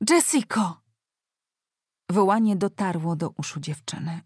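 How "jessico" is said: put-on higher-pitched child calling out; plaintive narrated cry, not too loud; child's cry. A narrator's reading. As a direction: call after someone as a distant child. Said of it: "rozległ się krzyk dziecka".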